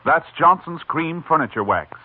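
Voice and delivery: ordinary delivery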